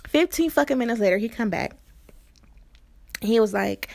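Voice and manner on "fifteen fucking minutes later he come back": ordinary delivery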